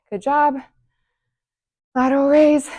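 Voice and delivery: breathless between moves; panting through the cues; out of breath. Real, not acted. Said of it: "I am so out of breath".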